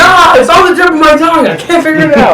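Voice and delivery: high-pitched frustrated character voice; imitating a character. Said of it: "He's like".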